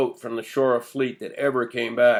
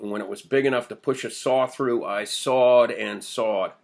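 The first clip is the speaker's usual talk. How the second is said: plain reading voice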